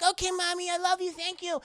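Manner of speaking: put-on good little kid thanking mommy; little boy voice.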